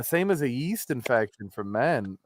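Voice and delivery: usual speaking voice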